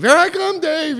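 high teasing falsetto; sing-song mock to the father. Family mocking in high voice.

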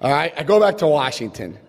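usual speaking voice

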